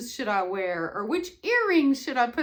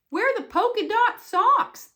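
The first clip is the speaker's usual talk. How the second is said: bus driver; 10 semitones higher, 8 dB louder than usual. Acted. Bus driver voiced in story.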